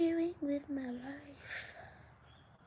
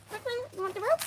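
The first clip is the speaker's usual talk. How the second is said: high, louder sing-song voice to the dog; pet voice; 9 semitones higher, 6 dB louder, much faster than usual. Real, not acted. Talking playfully to her dog.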